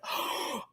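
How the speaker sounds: sharp shocked gasp; sudden intake of breath; re-enacted gasp. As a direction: gasp as if stunned by the reveal. Re-enacting shocked reaction to reveal.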